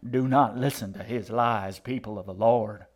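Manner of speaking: loud preacher's command; forceful, growling old woman; growled warning. A narrator's reading. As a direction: warn the flock against a liar. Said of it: "the old woman growled".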